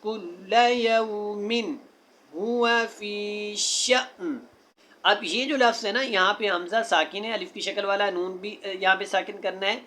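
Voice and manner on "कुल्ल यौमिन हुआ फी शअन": slow melodic tajweed recitation; Quran recitation. Shown as a demonstration. Teacher recites the verse to students in slow chant. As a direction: chant each word with care.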